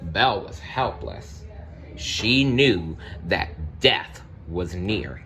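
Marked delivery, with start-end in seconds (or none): none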